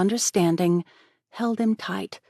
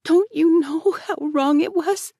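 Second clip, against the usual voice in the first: a touch of tears; 12 semitones higher, 6 dB louder, slower than usual. A narrator's reading. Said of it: "with a quiver in her voice".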